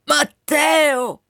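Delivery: high, urgent call. Acted. Colonel calls the Wind as it leaves, urgent cry. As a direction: call a departing friend back, pitch jumping up. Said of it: "gridò allora il Procolo".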